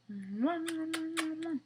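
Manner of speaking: string of high, loud smacking kisses; blown kisses. A narrator's reading. Snow Queen blowing kisses around the room, high loud smacks. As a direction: blow kisses all over the room. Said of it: "She's blowing kisses around the room".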